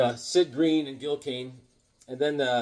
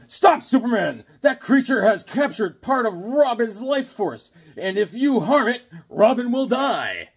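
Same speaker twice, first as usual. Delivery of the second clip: high, loud, urgent hero's warning; Batman; 6 semitones higher, 5 dB louder than usual. Acted. Hero warning his ally about a captured friend, melodramatic comic-book cry. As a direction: cry it out dramatically, stretching the key words. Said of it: "Batman's going".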